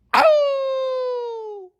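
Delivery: long, high imitated dog howl; high-pitched hound's howl, drawn out. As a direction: howl like a dog, sliding slowly down. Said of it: "his dog was just howling".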